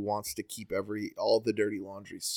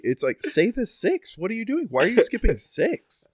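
high, louder exasperated questioning; 10 semitones higher, 5 dB louder than usual. Real, not acted. Complaining about the cheer mom, loud high exasperation. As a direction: demand it in high disbelief.

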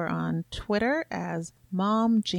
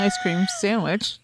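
talking through a mouthful; chewing while talking. Real, not acted. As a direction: mouth full, words round a bite. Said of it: "I'm chewing on my".

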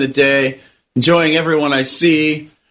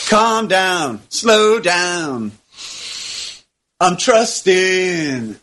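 put-on old southern gentleman; 3 semitones higher, much slower than usual. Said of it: "He really talks like a Southern gentleman".

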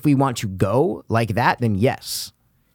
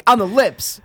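high mock voice; 9 semitones higher, slower than usual. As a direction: squeak it out teasingly. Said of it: "You're like".

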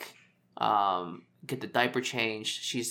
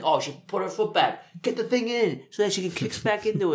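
higher-pitched frantic hurry; mock urgency; 8 semitones higher, 3 dB louder than usual. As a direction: act out a split-second scramble. Acting out rush to dress baby.

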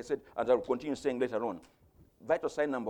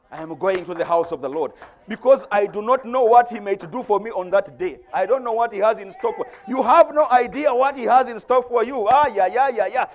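loud, swelling testimony; preacher carried away with expectation; rousing preaching; 9 semitones higher, 13 dB louder than usual. Real, not acted. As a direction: build in pitch and force to an excited cry. Preaches at full force.

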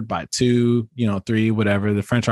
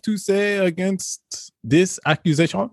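town crier; 7 semitones higher than usual. Acted. Moderator addresses Mexican side.

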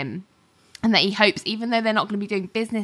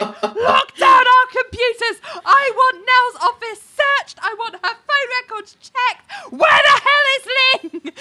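frantic shouting boss; 6 dB louder than usual. Retold or imitated. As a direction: bark orders like the office is at war. Boss storming through office, panicking.

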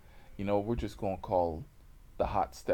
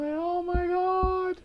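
very high, stretched-out wail; mock horrified shock; much slower than usual. Driver reacting to the screaming passenger, high mock shock. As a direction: squeal it slowly in comic disbelief.

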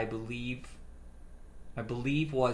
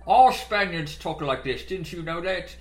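put-on louder, slow Scottish-accented mentor voice; Connery as Ramirez; 4 semitones higher, 6 dB louder than usual. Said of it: "And he played Ramirez".

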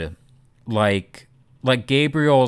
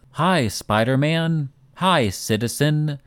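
higher-pitched, stilted hello; mock awkward greeting; 7 semitones higher than usual. Imitating two people throwing off suspicion, mock awkward. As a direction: act out a put-on, awkward exchange of greetings. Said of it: "sort of awkward lead-in".

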